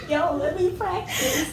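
wheezing laughter into words; silent wheezing laugh. Real, not acted. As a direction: laugh silently, then squeak out. Breathless laughing fit.

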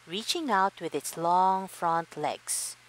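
ordinary delivery

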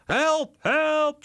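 very high, loud cry for help; shouting for help. A narrator's reading. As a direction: yell help at the top of the voice. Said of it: "schreeuwt Charlie". (luid hulpgeroep, heel hoog)

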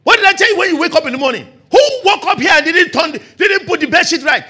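loud, high, rapid nagging shout; scolding mother. Retold or imitated. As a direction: tear into the child like a cross mum. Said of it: "The mother say".

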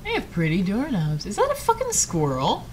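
ordinary talk, nothing marked

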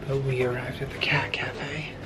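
hushed whisper. Real, not acted. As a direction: whisper so the cats stay calm. Whispering in cat cafe.

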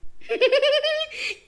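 high, sustained giggle; sly, high-pitched tittering; gleeful giggle. Acted. Patient delighted to be going home, giggling.

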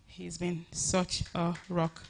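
"rock" drawn out, each word slow and weighed; slow heartfelt repeat. Real, not acted. Thanking her husband Femi again, slow and heartfelt. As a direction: let it sink in, slowly, every word meant.

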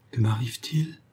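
whispered, stunned question; devastated murmur. A narrator's reading. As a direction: murmur it as if crushed. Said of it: "murmura Béatrix anéantie".

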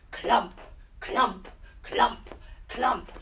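heavy rhythmic clump clump; clumping footsteps; clumping sounds. Acted. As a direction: stomp the words out. Clumping through mud.

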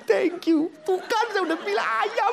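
high wife's voice, pleased and triumphant; smug wife. Acted. Wife to husband after winning: high, triumphant told-you-so. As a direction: gloat in a high chirpy voice.

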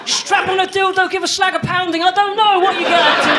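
overly enthusiastic dad; hammed-up cheer. Dad cheering his daughter's coming out, in a hammed-up shout. Dad's imagined cheerful reply.

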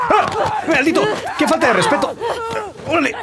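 sudden high startled yell at a lurking enemy; startled shout. Real, not acted. Gamer startled by an enemy, yelling high in shock.

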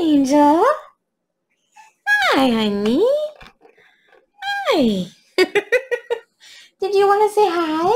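high, gushing voice for the dog. Real, not acted. Greeting her dog again.

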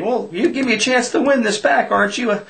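high-pitched mock plea for another chance; retold pleading. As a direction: plead high, acting out the sore loser.

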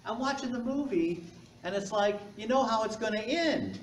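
lightly voiced spinster teacher complaining; a hammed-up impression of a disappointed teacher; mimicking the teacher. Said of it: "She goes".

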